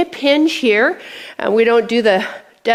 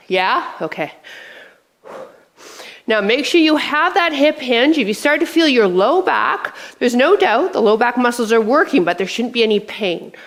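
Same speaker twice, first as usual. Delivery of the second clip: usual speaking voice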